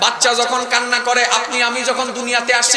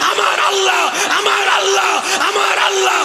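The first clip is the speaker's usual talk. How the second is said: climactic sermon cry; 6 semitones higher than usual. Acted. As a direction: lift it to the climax.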